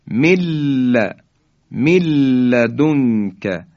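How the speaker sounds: drawn-out "milla", then من لدنک as "milladunka"; doubled lam. Shown as a demonstration. Teacher repeats the merged form, nun deleted, lam doubled. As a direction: drop the n of من and hold the l into لدنک.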